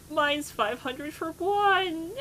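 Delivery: high, loud, slow mock wail; exaggerated outraged cry. Retelling tire prices to friends, outraged mock cry. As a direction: stretch it out like it's a scandal.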